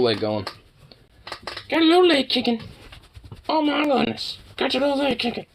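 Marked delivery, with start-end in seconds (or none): 1.7-5.5 very high cooing voice for the dog. Real, not acted. High baby talk to dog.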